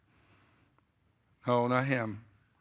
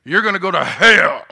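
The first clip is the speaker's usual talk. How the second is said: much higher and louder, thundering warning; fire-and-brimstone shout; 6 semitones higher, 13 dB louder than usual. Retold or imitated. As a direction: hammer the word hell from the pulpit. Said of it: "that's how he talked".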